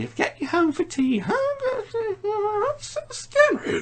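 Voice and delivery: retelling the lady character afraid of the man; scared lady voice. Retold or imitated. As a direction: squeak it out like a scared old lady. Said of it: "the lady character".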